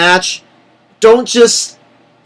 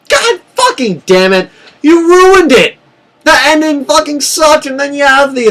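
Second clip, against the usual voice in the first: high, loud outraged yell; furious rant; 10 semitones higher, 4 dB louder than usual. Real, not acted. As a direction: vent at the bookers who ruined it. Fan ranting about ruined ending.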